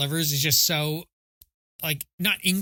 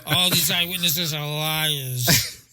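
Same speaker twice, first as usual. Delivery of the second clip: mock courtroom denial; slower than usual. Mocking Bobby Kraft in court.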